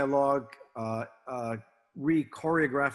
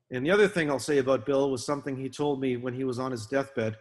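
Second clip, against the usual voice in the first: ordinary delivery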